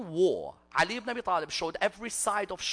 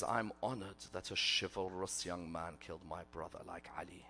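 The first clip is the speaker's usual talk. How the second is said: very soft, low acted reverence; quietly honored; 11 semitones lower, 11 dB quieter than usual. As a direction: say it with hushed, solemn pride. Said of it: "she gets up and she smiles".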